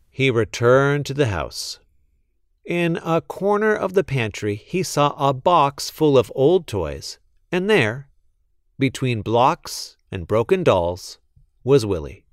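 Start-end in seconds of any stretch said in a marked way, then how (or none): none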